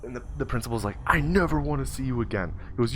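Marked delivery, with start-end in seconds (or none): 1.0-2.5 higher, stern dismissal; banishing someone, mock stern; angry principal. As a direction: act out an outraged principal. Principal throwing boy out.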